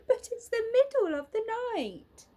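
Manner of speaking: amused little bear. Acted. Little Bear laughing at lunch at midnight, high and amused. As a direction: giggle at such a silly idea.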